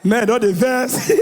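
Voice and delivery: normal talking voice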